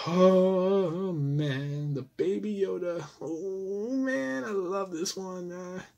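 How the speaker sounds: high, drawn-out swoon of delight; adoring gush. Real, not acted. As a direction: gush over it, stretching the words high. Gushing over his Baby Yoda toy.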